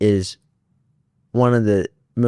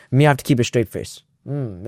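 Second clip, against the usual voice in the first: ordinary talk, nothing marked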